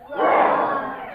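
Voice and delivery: breathy roar like a tiger; mock tiger roar; loud tiger roar. Shown as a demonstration. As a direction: roar it out like a big cat. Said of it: "you're gonna go".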